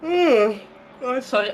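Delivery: sighing apology after a yawn; slow, sleepy sigh. Real, not acted. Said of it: "trying to hold that yawn back".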